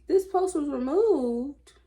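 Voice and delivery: slow, high and loud mock dismay; announcing the post is gone with exaggerated disappointment. Real, not acted. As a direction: drag it out in mock disbelief. Surprised post removed.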